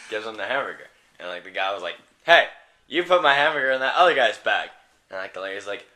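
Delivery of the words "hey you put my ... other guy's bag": mock-indignant, louder complaint; indignant customer. Customer confronting the clerk over his hamburger, indignant. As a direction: call out an accusation with playful indignation.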